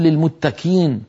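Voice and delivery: "للمتكين" said with a kaf sound; slow wrong "للمتكين" with a k sound; qaf turned to kaf. Shown as a demonstration. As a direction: demo the mistaken "للمتكين". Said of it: "فلا يصح أن نقول".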